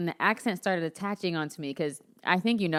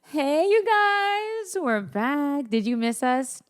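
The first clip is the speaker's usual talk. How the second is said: high, slow, drawn-out mock Kardashian greeting; Kardashians impression; 7 semitones higher, much slower than usual. Host imitating the Kardashians greeting podcast listeners, high drawn-out mock voice. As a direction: stretch it out like a reality TV star saying hi. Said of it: "The Kardashians".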